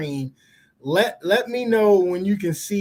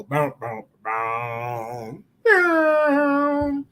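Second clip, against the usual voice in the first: imitating a soundboard hit; deep booming sound effect; 3 semitones lower, much slower than usual. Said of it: "hit my soundboard and go".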